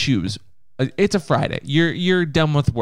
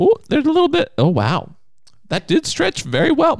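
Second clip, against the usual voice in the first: excited; 12 semitones higher than usual. Pretend. Playful wedgie commentary.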